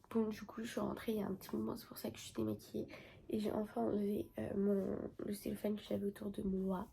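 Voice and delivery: parler tout bas, presque chuchoté; voix basse. Real, not acted. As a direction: raconter son tatouage doucement, tard le soir. Quiet late-night talk after returning. (voix très basse et posée)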